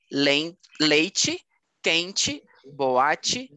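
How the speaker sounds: "leite, quente, boate" each ending in "chee"; final TE as CHI. Shown as a demonstration. Teacher models word list, "leite, quente, boate" ending in "chee". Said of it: "Todo com ti no final".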